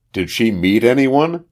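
narrator's understated interrogation; demanding question. A narrator's reading. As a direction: press for an answer. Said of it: "demanded Williams".

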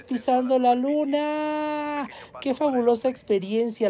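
high, drawn-out final word; excited announcement. Pretend. Host play-acting a moon landing, excited announcement. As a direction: stretch it out triumphantly, high and sustained.

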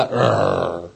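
a low little doggy grr; dog growl. Retold or imitated. The dog growls back at the boy, a soft mock growl. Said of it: "me gruñe".

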